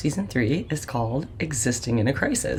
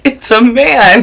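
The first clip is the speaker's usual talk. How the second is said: high, loud mock proclamation; booming announcement; 9 semitones higher, 13 dB louder, slower than usual. Doctor announces the enormous newborn, loud mock amazement. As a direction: belt out the punchline in amazement. Doctor's punchline about huge baby.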